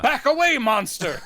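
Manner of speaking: loud, high order to back off; mock alarmed shout. Father confronting the creature at home, mock alarmed shout. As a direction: shout it like a hammed-up horrified father.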